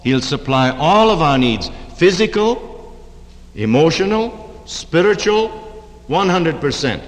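all leaned on with a lift; stress on all. Shown as a demonstration. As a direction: punch the word all and each need after it. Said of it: "he emphasized that word all".

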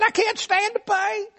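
high, tearful whine about pain; whimpering hypochondriac. Retold or imitated. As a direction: whine like every touch is agony. Arzy moaning in pain.

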